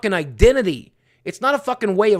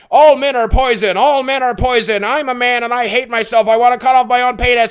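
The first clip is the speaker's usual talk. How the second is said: higher, louder mocking rant; louder mocking parody of an online guy; mock self-hating guy; 5 semitones higher, 7 dB louder than usual. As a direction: ham up a whiny self-loathing man.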